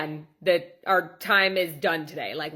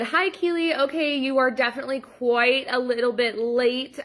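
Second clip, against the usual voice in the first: fake polite; 5 semitones higher than usual. Fake polite greeting to late client.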